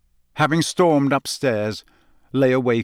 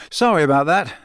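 louder, biting sarcastic apology; angry sarcasm; 5 semitones higher, 5 dB louder, faster than usual. A narrator's reading. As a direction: snap it back, stung. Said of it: "his face reddening with anger".

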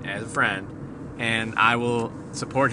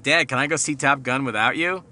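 put-on higher, louder kid's plea; imitated son asking; 5 semitones higher, 6 dB louder than usual. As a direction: mimic a kid asking dad's permission. Son asking dad for permission.

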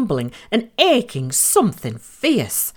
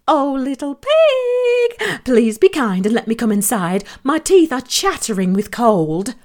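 lying sweet wolf; 6 semitones higher than usual. A narrator's reading. Wolf lies to the pigs to get inside, sweetly. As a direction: coax the pig with a false plea. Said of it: "lied the wolf".

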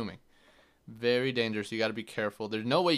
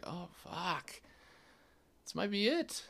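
retold dread; 8 semitones higher, 6 dB quieter than usual. Retells dread while held underwater.

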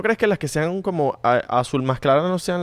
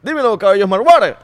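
loud, high mock shout; shouted quote; 7 semitones higher, 8 dB louder than usual. Friend acting out a line about Smart Water, shouting. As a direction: belt it out high like a hype call. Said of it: "y dice".

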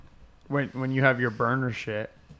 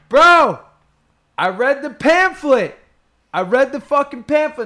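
hysterical punchline; 11 semitones higher, 11 dB louder than usual. Retold or imitated. Imitating comedian to his worried boys, hysterical. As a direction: shout it like the big reveal.

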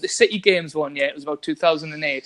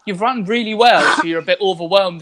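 normal talking voice

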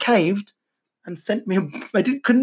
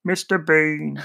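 Mister Bean impression; slower than usual. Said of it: "I can do this one".

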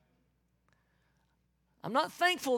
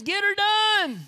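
loud high Southern holler; Southern catchphrase; 9 semitones higher, 8 dB louder, slower than usual. Acted. Preacher quoting a Southern saying, loud Southern holler. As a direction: belt it like a proud Southerner. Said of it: "Good old Southern statements".

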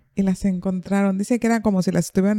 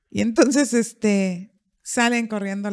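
ordinary talk

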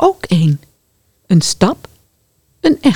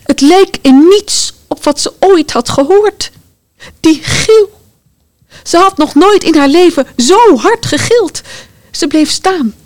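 shaken narration; 9 semitones higher, 3 dB louder than usual. A narrator's reading. Narrator after scream.

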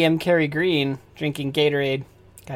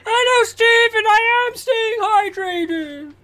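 excited fan voice. Acted. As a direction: shout up from the crowd like an eager fan. DM voices audience member.

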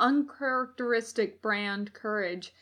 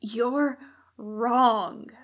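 gasped defiance; 4 dB louder than usual. A narrator's reading. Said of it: "Crowley gasped".

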